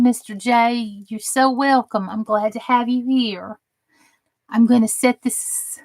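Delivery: ordinary delivery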